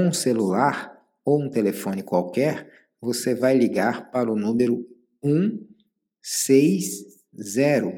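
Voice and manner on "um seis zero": slow dictation. Real, not acted. Dictating phone number slowly.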